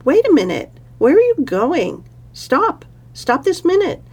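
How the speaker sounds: high, loud calling out; calling after him. Acted. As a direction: shout after him to stop. Parvati calling after Shiva.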